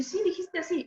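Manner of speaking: high, matter-of-fact confirmation; friend insisting. Retold or imitated. A friend confirming to the speaker that she misspoke, in a lightly imitated high voice. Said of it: "Me dice".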